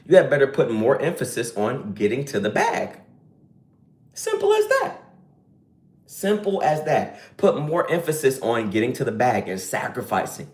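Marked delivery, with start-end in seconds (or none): 4.1-5.0 high playful. Shown as a demonstration. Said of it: "said twice".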